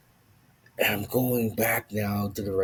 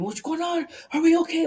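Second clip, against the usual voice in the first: high, loud imitation of a panicked girlfriend; mock a rattled scream, pitched way up; yelling and screaming; 6 dB louder, faster than usual. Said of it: "She starts to yell and scream".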